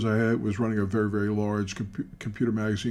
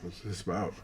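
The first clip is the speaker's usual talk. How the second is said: dropped to a soft, low aside; hushed aside to buddy; 3 semitones lower, 9 dB quieter than usual. Retold or imitated. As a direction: lean over and murmur it to your friend. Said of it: "and I'm going like".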